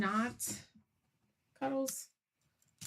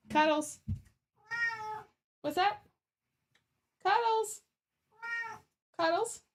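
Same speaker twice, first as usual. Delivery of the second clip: much higher sing-song calling of the cat's name; pet voice; 12 semitones higher than usual. Real, not acted. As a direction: call the cat in a cooing high voice. Calling the cat sweetly.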